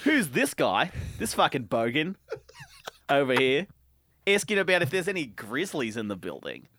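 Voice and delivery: exasperated Aussie, swooping pitch; hammed-up Australian disbelief; put-on Australian accent. Concertgoer scorning the bogan's question, put-on Australian accent. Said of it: "in an Australian accent".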